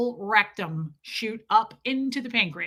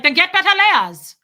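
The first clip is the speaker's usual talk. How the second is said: put-on very loud, high German-accented order; explosive German accent; terrifying German accent; 9 semitones higher, 11 dB louder than usual. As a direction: imitate a fierce German woman losing patience. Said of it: "she was terrifying".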